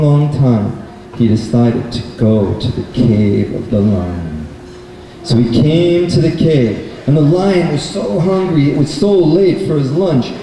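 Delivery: ordinary delivery, nothing marked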